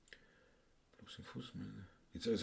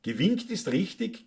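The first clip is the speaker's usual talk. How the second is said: usual speaking voice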